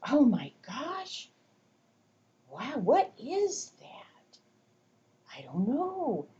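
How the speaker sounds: high, slow, hushed amazement; mock amazed whisper; playful wonder. Shown as a demonstration. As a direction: lure the child with curiosity. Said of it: "So I'm going".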